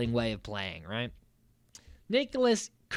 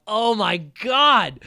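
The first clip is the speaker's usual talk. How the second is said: mock amazed; 6 semitones higher, 7 dB louder, much slower than usual. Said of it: "And I'm like".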